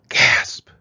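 loud, sudden "gasp!"; startled gasp. A narrator's reading. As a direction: burst the word out in shock. Startled reaction in the story.

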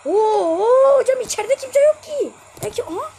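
high, loud wailing complaint; near-sobbing protest; anguished yell. Real, not acted. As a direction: cry out in despair at the screen. Frustrated gamer yelling at game.

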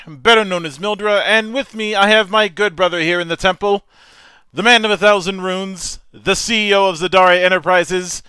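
loud, high, grand announcer opening; show host intro. Acted. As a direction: welcome the audience like a showman host.

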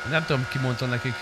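ordinary talk

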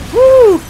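very high, loud whoop. Real, not acted. Player cheering amid grenades, high whoop. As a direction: let out a piercing woo.

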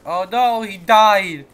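high, loud cry of alarm; shocked cry. Real, not acted. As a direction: yelp it out in sudden alarm.

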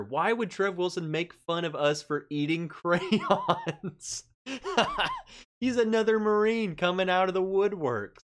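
talking through real laughter; cracking up mid-sentence; laughing. Real, not acted. Laughing at chat joke.

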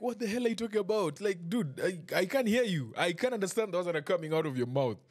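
lightly voiced baffled driver; baffled mock quote. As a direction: play confused disbelief for laughs. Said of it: "the guy is like".

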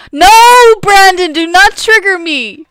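loud, high playful scolding; mock outraged shout. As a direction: ham up being offended at Brandon. Mock upset at Brandon.

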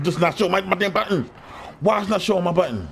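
real rising irritation; exasperated. Real, not acted. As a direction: gripe at a game that won't cooperate. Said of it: "I'm getting pissed off now".

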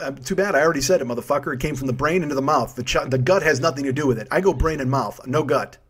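ordinary talk

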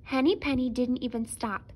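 usual speaking voice

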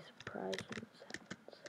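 scheming whisper. Real, not acted. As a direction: whisper the plan gleefully. Plotting a trap for attacker.

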